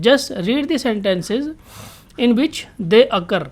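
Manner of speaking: reads the English line very slowly, word by word; slow reading aloud. Shown as a demonstration. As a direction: spell out the instruction slowly for students. Teacher reads textbook instruction aloud. (धीरे-धीरे पढ़कर सुनाना)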